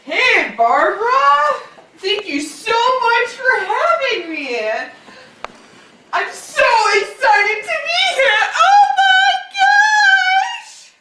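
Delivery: high squealing excited greeting; excited shrieking. Acted. Guest's excited entrance shrieking.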